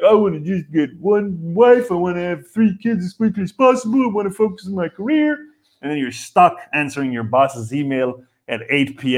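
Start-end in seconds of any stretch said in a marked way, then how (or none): 0.1-5.4 higher, mock earnest young go-getter listing plans; hammed-up eager voice, climbing higher; mock naive planner. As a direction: parody the guy who thinks he has life figured out. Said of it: "you're like".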